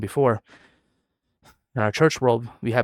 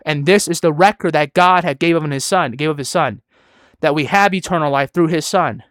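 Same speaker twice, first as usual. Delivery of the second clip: loud, insistent pulpit delivery; forceful preaching; 3 semitones higher, 5 dB louder than usual. Real, not acted. Preacher refuting those who say salvation can be lost, forceful preaching. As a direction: hammer the scripture home.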